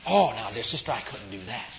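lightly voiced polite refusal; proud brother. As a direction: play the proud brother turning her down softly.

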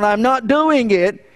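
high, drawn-out refusal; stubborn refusal. Acted. Hard-headed believer refuses God's call, stubborn, high and slow. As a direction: dig in, stretching out each word. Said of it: "That means you're so bent on".

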